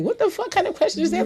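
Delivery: high, quick incredulous comeback; retold indignation. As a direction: fire back, offended by the question. Retelling her offended comeback to him.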